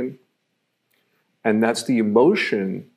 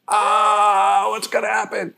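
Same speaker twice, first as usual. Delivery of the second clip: much higher, mock frightened gasp; scared viewer; 11 semitones higher, slower than usual. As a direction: react like you're trapped in the horror film. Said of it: "it's like".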